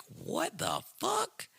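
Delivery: high, drawn-out mock disbelief; stretched, high-pitched incredulous question; retold shock. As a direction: act out a stunned husband, rising at the end. Retold husband's shocked reaction.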